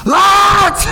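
sudden high, loud yell; imitated girl shouting at full pitch; worked-up shout. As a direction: blast the name out to jolt a sleeper awake. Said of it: "this little girl gets all up".

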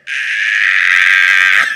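loud shrieking "Ah!" of terror; blood-curdling scream. Retold or imitated. Disciples seeing a figure on water, blood-curdling scream. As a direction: let out a full horror scream. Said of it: "blood-curdling screaming".

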